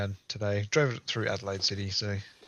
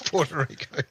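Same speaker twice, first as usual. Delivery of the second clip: put-on Japanese accent; 3 semitones higher than usual. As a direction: giggle through a mock Japanese accent. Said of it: "you sound like you're putting on a Japanese accent".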